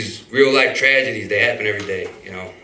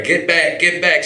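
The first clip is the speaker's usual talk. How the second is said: retold scream; 6 semitones higher, 3 dB louder, slower than usual. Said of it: "I start screaming".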